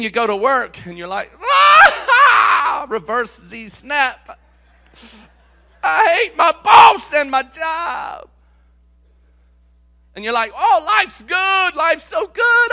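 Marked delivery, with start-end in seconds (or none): 1.4-8.3 high loud yelp, then a slow shouted complaint; mock fed-up rant. Preacher imitating a churchgoer at work, hammed-up angry shout. Mocks worker hating the job. 10.6-12.7 mock cheerful. Mocks cheerful churchgoer.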